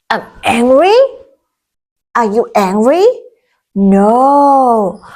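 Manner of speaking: ordinary talk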